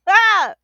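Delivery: a bright laugh into a loud high ah; laugh and yelp. Real, not acted. Coach laughing at her own joke, loud. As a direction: burst out laughing at herself.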